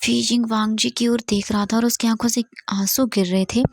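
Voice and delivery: ordinary delivery, nothing marked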